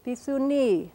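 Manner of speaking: "Pisuni" with a long accented final ni; last-syllable stress. Shown as a demonstration. Lecturer showing students the Comanche skunk word, long stressed ending. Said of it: "accent on this last syllable".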